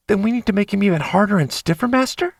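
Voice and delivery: quick, high, teasing voice; eager sub voice, rising to Master; eager playful young man. A narrator's reading.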